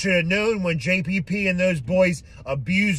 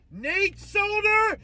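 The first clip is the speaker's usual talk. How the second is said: very high and slow; high drawn-out mocking call; taunting sing-song; 4 dB quieter, slower than usual. Real, not acted. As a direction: sing his name out mockingly. Taunting departing player.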